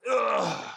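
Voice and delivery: high-pitched "ugh" of effort; groan. Real, not acted. Man working on the frame under a vehicle, groaning. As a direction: let out a high, strained groan.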